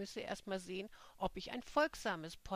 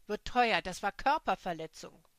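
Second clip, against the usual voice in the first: furious, louder warning; indignant outburst; loud threat; 9 dB louder than usual. Acted. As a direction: threaten her with legal fury. Jenny threatens after slap.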